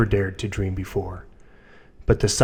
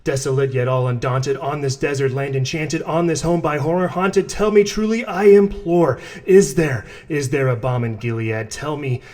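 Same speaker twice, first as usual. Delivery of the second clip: higher, anguished imploring cry; desperate pleading; 4 semitones higher than usual. Acted. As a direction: beg the prophet, voice climbing. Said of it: "I cried".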